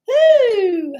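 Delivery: high, loud joyful woo with a laugh; excited whoop. Real, not acted. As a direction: let out a happy cheer. Cheering at stream start.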